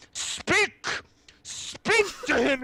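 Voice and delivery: high-pitched urgent demand; frantic, high acted outburst; very excited. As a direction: squeal the command in excitement. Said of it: "gets very excited".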